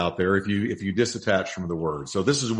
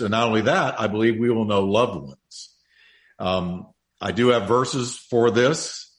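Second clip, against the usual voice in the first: ordinary talk, nothing marked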